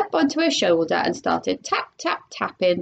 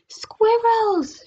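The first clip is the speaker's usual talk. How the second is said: mock shout; 11 semitones higher than usual. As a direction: squeal the word out. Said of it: "shouted Veruca Salt".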